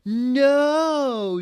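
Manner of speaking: a long, very high, very loud shriek; hammed-up horror-movie scream. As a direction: scream like you're in a horror film. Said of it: "she screamed".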